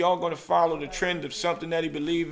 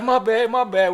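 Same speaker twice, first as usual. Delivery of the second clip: much higher, louder flustered apology; mock-flustered friend saying sorry; hasty apology; 8 semitones higher, 8 dB louder than usual. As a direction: apologise fast like you just got caught. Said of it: "He was like".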